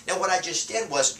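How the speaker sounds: ordinary talk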